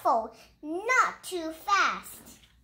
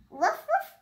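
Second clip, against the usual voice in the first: woof woof, higher than the story voice; high puppy bark; 11 semitones higher than usual. Acted. Child reading a puppy book, high puppy bark. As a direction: yip like a small dog.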